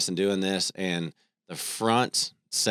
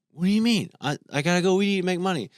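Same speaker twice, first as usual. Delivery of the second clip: high, louder, fast mock protest of a teen; indignant son; 12 semitones higher, 5 dB louder, faster than usual. Dad voicing his son protesting that he must work, high fast mock protest. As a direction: rush it up high like an outraged kid.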